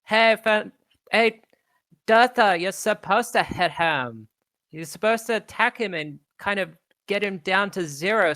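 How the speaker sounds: put-on an elemental girl correcting her teammate; elemental girl calling out. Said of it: "elemental girls go".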